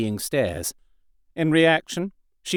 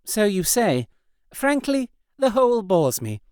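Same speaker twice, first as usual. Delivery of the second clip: contrived lightness; 8 semitones higher than usual. A narrator's reading. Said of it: "with contrived lightness".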